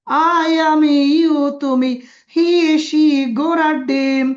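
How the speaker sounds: loud, high, drawn-out mock chant; scornful singsong word list; mocking recitation. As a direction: parody a rote English drill, stretching each word. Mocking how English is taught in Bangladesh.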